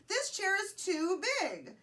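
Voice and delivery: high, slow mild yell; storybook yell. A narrator's reading. Goldilocks complains about the big chair, mildly yelled. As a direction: call it out high and drawn out. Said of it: "Goldilocks yelled".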